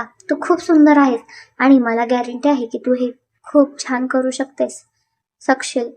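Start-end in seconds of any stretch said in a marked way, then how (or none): none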